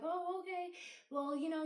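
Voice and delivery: a startled, thrown-off reply; shocked. Retold or imitated. Therapist hearing it's the last session, high startled surprise. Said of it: "like, shocked".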